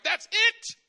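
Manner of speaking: loud, high, furious order; throwing someone out. Pretend. Host to a disrespectful guest, loud and furious. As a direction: throw them out with a yell. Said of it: "You're like".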